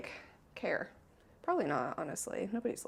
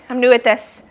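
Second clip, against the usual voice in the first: usual speaking voice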